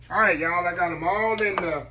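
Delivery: usual speaking voice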